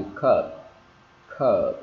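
long falling CURB with lips shut on the B; lips closed on final B. Shown as a demonstration. Teacher showing students the final B, lips closed. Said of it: "ngậm cái môi vào".

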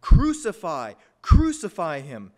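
loud, forceful shout; crowd shouting. A narrator's reading. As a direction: cry it out like an insistent crowd. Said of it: "but they kept shouting".

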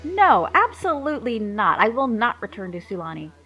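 loud, high, furious refusal; angry. A narrator's reading. Wife refusing to return to her father's kingdom, angry shout. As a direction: snap back in outrage, voice up and loud. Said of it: "Filet replied angrily".

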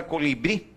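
colibrí with the final -brí stressed; stress on last syllable. Shown as a demonstration. Teacher showing students where colibrí is stressed, final syllable strong. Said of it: "Ela tem maior intensidade de voz".

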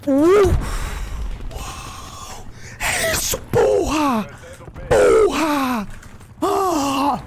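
high hyped gamer yells; excited yelling. Real, not acted. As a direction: shout with glee. Hyped after kill.

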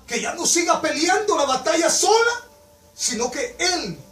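high, loud cry then slowing on "Él"; impassioned preaching. Acted. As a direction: cry it out to the congregation. Climax of the altar call.

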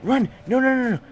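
high loud panicked cries; panicked yelling. Real, not acted. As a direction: panic as you run. Panicking near explosive.

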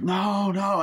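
high, drawn-out pleading; mock panicked cry. Man pursued by the werewolf, panicked cry. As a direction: cry out while being chased. Acting victim being chased.

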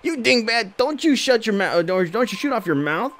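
high, comic goon snapping; squeaky thug scolding partner. Acted. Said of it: "it's like".

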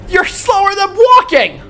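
high, loud yell; exasperated yell. Real, not acted. Horse too slow.

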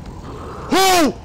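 very high, falling "whoa"; sudden shriek of surprise; high yelp of alarm; startled whoop. Real, not acted. Startled by attack.

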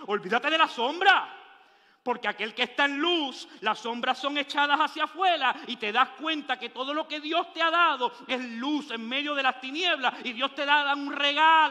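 loud, driving sermon cadence; preaching at full force. Acted. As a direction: preach it out to the congregation. (predicando con toda la fuerza)

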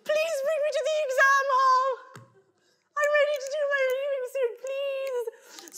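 very high, breathless pleading; hyperventilating plea; breathless desperate teen. Retold or imitated. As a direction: gasp it out in panic. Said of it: "I'm just like".

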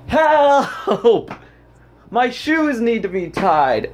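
high shrill cry of help; mock scream for help. Shrieking for help over an untied shoe. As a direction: yelp for help like a helpless child. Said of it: "Why are you screaming".

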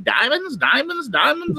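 high, sing-song hawker's call; street hawker. Retold or imitated. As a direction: call out the wares like a street seller. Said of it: "He was like".

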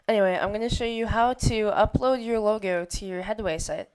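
usual speaking voice

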